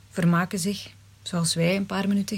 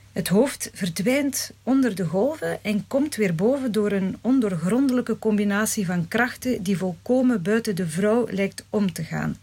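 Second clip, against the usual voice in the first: plain reading voice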